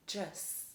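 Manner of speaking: jus said as "juss"; demonstrated suffix sound. Shown as a demonstration. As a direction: jus as at the end of religious. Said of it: "the sound will be jus".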